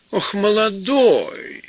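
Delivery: sarcastic exclaim, stretched way up. Acted. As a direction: scoff in high, slow disbelief. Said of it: "воскликнула она".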